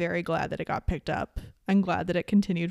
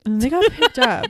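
higher, louder, mock-dramatic repeat; dramatic echo; 6 semitones higher, 7 dB louder than usual. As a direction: echo it with a big dramatic flourish.

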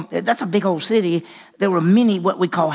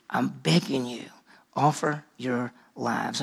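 softer, lower, slow begging; hushed plea; 5 semitones lower, 7 dB quieter, slower than usual. Retold or imitated. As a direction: plead almost under the breath. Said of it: "Paul's saying".

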